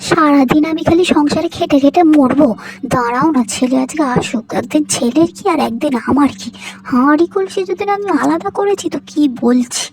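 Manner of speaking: loud, fuming threat; loud angry threat. Acted. Mother-in-law threatening to separate the kitchen, loud fury. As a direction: fume loudly, swearing to split the household.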